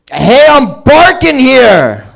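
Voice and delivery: high, slow, loud New York "Hey" yell; New Yorker impression. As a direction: belt it like a cabbie leaning out the window. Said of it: "This is a dog from New York".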